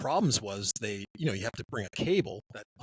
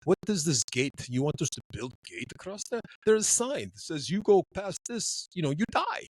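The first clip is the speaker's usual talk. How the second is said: mimicked Russian, climbing high in pitch; hammed-up explaining of the obvious; baffled Russian; 4 semitones higher than usual. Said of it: "the Russians didn't have this safety chain".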